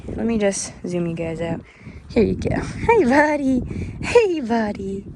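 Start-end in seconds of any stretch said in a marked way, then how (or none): 2.6-5.0 pet talk. Real, not acted. Greeting cow in pet voice.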